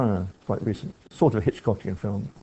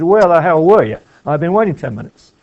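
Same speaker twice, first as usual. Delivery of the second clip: loud, higher, curt challenge instead of a greeting; playing Wayne, much louder and higher, ticking someone off for being late; John Wayne style; 6 semitones higher, 11 dB louder than usual. Shown as a demonstration. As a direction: hit it hard and loud like a movie cowboy. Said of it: "He says".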